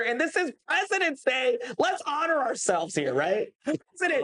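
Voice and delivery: high, grand joking toast; mock celebration. To the host, jokingly honoring fellow presidents, high and grand. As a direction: hail yourself, pitch way up.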